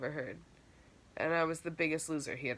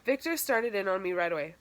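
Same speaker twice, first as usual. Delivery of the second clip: ordinary delivery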